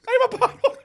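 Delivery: very high, loud laughing confession; squeaky laughing. Real, not acted. Guest admits being picky, laughing high.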